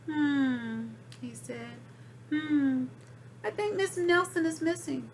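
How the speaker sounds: a hint of a puzzled sleuth; lightly voiced dim detective. Detective mulling over the case, a touch puzzled.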